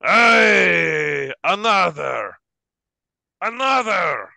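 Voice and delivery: loud, high drinker's holler; rowdy cheering shout. Acted. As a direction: holler for the next round. Drinkers cheer for another round.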